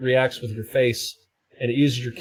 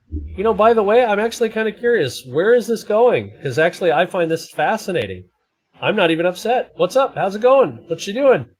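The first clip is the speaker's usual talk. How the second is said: higher, louder mock-cheery reply; mock-cheery chat to a troll; amused at a troll; 8 semitones higher, 3 dB louder than usual. Real, not acted. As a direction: needle the troll with fake interest. Talking back to a troll.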